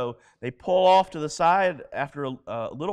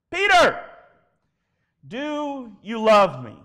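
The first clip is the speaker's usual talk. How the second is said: loud, high call, then slow question; 6 semitones higher, 8 dB louder, slower than usual. Retold or imitated. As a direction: call across to him, then ask deliberately. Jesus calls out to Peter.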